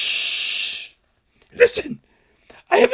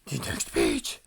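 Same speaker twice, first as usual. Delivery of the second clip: whispered dread; 12 semitones lower, 5 dB quieter, slower than usual. Acted. Dread before the end.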